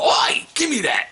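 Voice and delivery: high, slow mock-British shout; bad British accent. Imagined customer demanding in a gaming store, bad British accent. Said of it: "really bad British accent".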